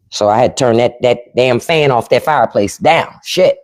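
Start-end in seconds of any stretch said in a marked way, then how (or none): none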